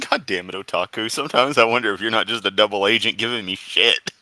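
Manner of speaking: ordinary delivery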